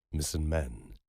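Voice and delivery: usual speaking voice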